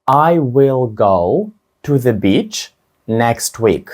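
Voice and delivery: very slow, word by word; drawn-out sentence for learners to repeat; teacher's very slow model of the sentence. Shown as a demonstration. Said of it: "спробуйте сказати".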